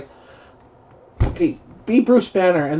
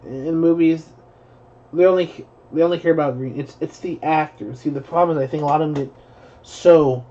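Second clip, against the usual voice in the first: usual speaking voice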